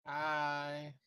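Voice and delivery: soft, drowsy greeting; sleepy. Real, not acted. Jack greeting friends after possibly just waking, sleepy. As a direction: say it softly, barely awake. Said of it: "You sound so sleepy".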